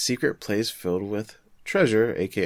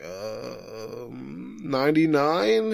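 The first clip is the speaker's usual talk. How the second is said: hesitant guess; 4 semitones higher, much slower than usual. Retold or imitated. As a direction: imitate a kid unsure of his answer. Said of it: "he's like".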